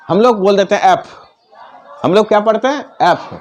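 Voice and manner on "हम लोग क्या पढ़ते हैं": ordinary delivery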